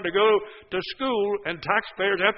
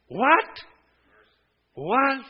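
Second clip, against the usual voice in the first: mock disbelief; 6 semitones higher than usual. Preacher mocks parents' deferral.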